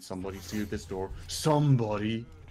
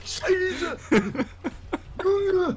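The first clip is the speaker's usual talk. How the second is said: mock Saxon shout; 6 dB louder than usual. Mocking the dialect after explaining.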